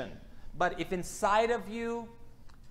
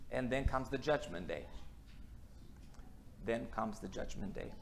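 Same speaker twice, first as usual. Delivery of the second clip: solemn, hushed; 7 semitones lower, 9 dB quieter than usual. Real, not acted. Solemn warning, repeated softer.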